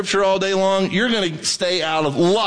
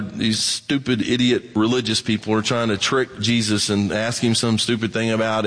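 usual speaking voice

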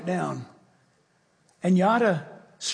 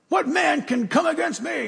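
Goliath challenge; 6 semitones higher than usual. Retold or imitated. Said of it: "Big Goliath standing there".

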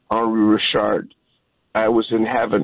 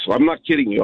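ordinary talk

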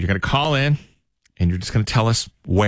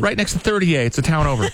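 high-pitched eager explanation; excited caller; 7 semitones higher than usual. Acted. Caller explaining the place to radio hosts, excited. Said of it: "he's very excited".

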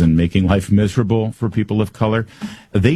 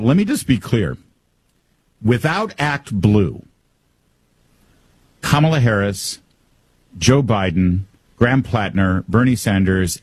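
ordinary delivery

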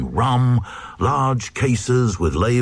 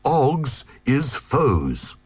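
mild cry; 6 semitones higher than usual. A narrator's reading.

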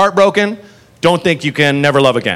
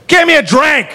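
put-on higher, loud drunk yell at the bar; drunk demand; 10 semitones higher, 3 dB louder than usual. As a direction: bark at the whole bar like a drunk. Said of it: "She goes".

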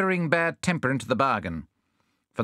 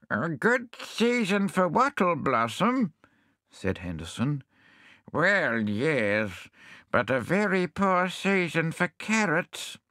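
higher, slow doddery voice; deaf hedgehog; 6 semitones higher, slower than usual. Acted. Deaf hedgehog asking the visitors to speak up. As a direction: plead for them to speak up.

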